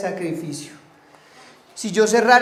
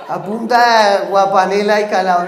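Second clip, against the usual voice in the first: said through laughter, louder; laughing; 7 dB louder than usual. Real, not acted. Joking to the congregation about his childhood diet, laughing. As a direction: laugh the words out.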